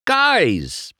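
high, bright call of joy; happy cry. A narrator's reading. McQueen to his friends, happily crying out. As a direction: shout it out happily. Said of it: "McQueen cried happily".

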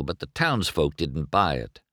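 ordinary delivery

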